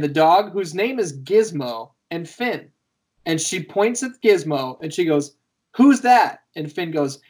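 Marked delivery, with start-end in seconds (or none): 5.8-6.4 higher, louder prompting question; quizzing a toddler. Retold or imitated. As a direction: imitate an adult prompting a small child. Sister-in-law quizzing a toddler.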